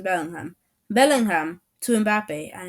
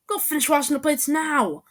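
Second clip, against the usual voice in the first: high-pitched bossy command; acted indignant shout; shouted order; 7 semitones higher, 3 dB louder than usual. A narrator's reading. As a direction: order him off like a judge passing sentence. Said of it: "I shouted".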